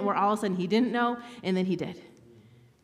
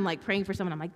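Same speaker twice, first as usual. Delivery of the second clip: normal talking voice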